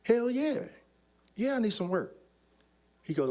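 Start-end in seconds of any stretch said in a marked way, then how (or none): 0.0-2.2 higher eager reply; eager hell yeah. Retold or imitated. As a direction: jump at the offer. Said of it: "I was like".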